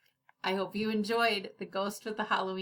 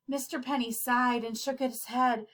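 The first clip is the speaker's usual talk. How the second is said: plain reading voice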